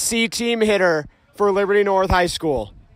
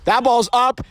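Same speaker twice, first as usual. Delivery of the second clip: normal talking voice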